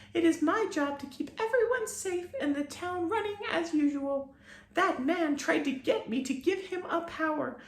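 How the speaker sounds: very high, gentle grateful fairy; fairy thanking her rescuer; tiny fairy voice. A narrator's reading. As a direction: thank the boy sweetly and tinily.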